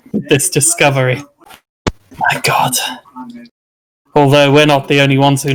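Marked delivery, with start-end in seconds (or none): 2.2-2.7 hushed, under-the-breath awe; awed whisper. Acted. Explorer stunned by an ancient discovery, awed whisper.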